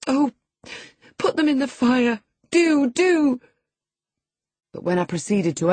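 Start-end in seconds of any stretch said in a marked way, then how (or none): 0.1-3.4 put-on much higher, louder, desperate girl; urgent pleading. A narrator's reading. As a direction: beg her to burn them now. Girl begging letters be burnt.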